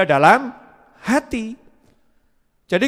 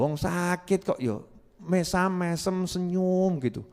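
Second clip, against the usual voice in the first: ordinary talk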